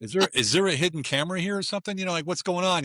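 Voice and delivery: high, incredulous questions; mock bewildered. Man stunned by her reaction, high incredulous asking. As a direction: act baffled, looking for a hidden camera. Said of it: "I was like".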